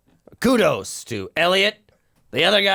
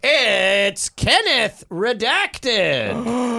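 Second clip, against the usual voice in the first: dramatic announcement; 8 semitones higher, much slower than usual. Acted.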